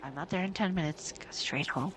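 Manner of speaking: put-on low, soft steady girl's voice; calm reassurance. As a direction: reassure a friend before danger. Voices Mio staying behind.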